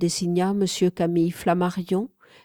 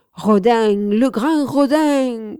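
loud and higher, a booming cry; roaring; 4 semitones higher, 7 dB louder, slower than usual. A narrator's reading. Bourdelle roars Rodin's name, booming loud. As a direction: roar the master's name in praise. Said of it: "Bourdelle rugit de nouveau".

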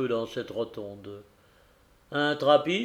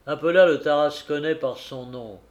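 ordinary delivery, nothing marked

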